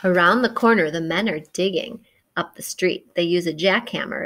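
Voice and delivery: ordinary delivery, nothing marked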